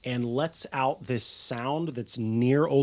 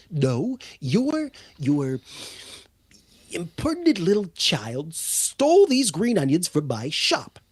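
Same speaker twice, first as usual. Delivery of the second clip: indignant gnome; 5 semitones higher than usual. Acted. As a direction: accuse the child, pitch up. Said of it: "the gnome, says".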